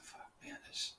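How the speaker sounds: whispered guilty curse to himself; regretful whisper. Retold or imitated. Man alone in his office regretting his outburst, whispering. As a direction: hush it as regret sinks in.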